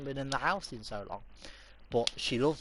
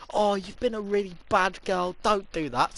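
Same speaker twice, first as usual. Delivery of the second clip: sister's gentle scolding; 7 semitones higher, 7 dB louder than usual. Retold or imitated. Sister gently scolding the dog, lightly mocked. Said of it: "nice and".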